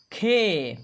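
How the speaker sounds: "khe" stretched out and falling; demonstrating "khe". Shown as a demonstration. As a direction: sound out "khe" for learners. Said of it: "खा के साथ ए की मात्रा है".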